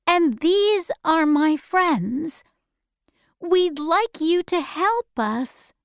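put-on a small girl answering politely; young girl Dorothy. A narrator's reading.

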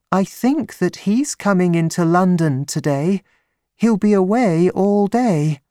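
high-pitched woman's voice; high, light character voice for a female visitor; young woman client, Miss Stoner. Acted.

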